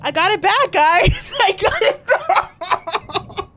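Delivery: high, loud cheer breaking into laughter; laughing triumph. Real, not acted. Player telling viewers the accent is back, laughing excitedly. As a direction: celebrate loudly, cracking up.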